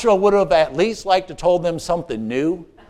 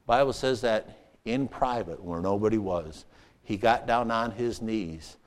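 much softer, lower, earnest teaching; quiet preaching; 7 semitones lower, 6 dB quieter than usual. Acted. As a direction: drop down low and intimate.

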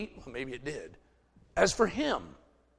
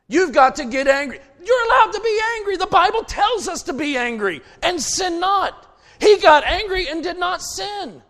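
loud, higher fiery sermon; preaching at full force; 10 semitones higher, 6 dB louder than usual. Real, not acted. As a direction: fire up the congregation.

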